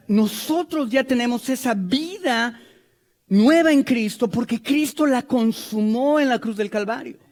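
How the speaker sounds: ordinary talk, nothing marked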